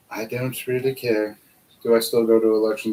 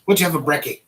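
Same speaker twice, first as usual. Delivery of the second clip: tentative Aussie slang; 4 semitones higher, 3 dB louder, faster than usual. Pretend. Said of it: "I think that's how you say it".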